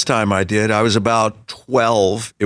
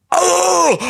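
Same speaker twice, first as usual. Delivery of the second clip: high sudden "Oh!" of recognition; shocked gasp; 3 dB louder than usual. Retold or imitated. Boy recognizes the killer's photo, high startled oh. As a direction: jolt of realization.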